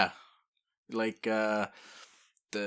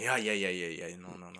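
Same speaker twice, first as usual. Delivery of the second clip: humble deflection; 3 semitones lower, 5 dB quieter than usual. Retold or imitated. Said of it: "being obviously very humble".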